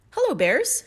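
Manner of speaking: put-on a cheery girl with her mouth full; high cheery hello; little girl greeting. A narrator's reading.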